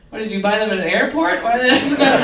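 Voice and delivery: loud, rising disbelief; incredulous. Retold or imitated. Comedian incredulously questioning the snooty woman's pricey shoes. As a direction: blurt it out in disbelief.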